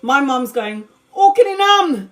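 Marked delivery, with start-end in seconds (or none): 1.2-2.1 Filipino mum swearing. Retold or imitated. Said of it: "She's Filipino, so she's swearing".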